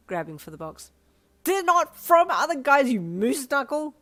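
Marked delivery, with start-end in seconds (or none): 1.4-3.9 high, loud, slow laughing yell; yelling half laughing. A narrator's reading. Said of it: "you yelled, half laughing".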